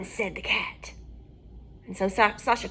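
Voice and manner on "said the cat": usual speaking voice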